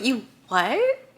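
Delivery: shocked disbelief. Retold or imitated. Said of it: "And Magnolia's like".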